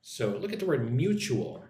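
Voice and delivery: ordinary talk, nothing marked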